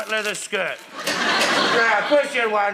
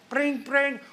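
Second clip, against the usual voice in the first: high ringing "brring brring"; imitated phone ring; 7 semitones higher than usual. Boss shows off calls coming in, high imitated ring. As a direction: trill it like an office telephone.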